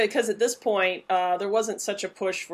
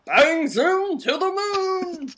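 loud, high, drawn-out blustering shout; hammed-up sitcom bluster, stretching each word; bad Jackie Gleason impression; 10 semitones higher, 7 dB louder, much slower than usual. Comedian doing a terrible impression of Gleason, shouting. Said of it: "there's a terrible impression right there".

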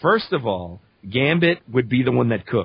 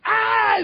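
short high mock scream; imitated scream. Retelling friends' reaction, high mock scream. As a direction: imitate friends yelling in shock.

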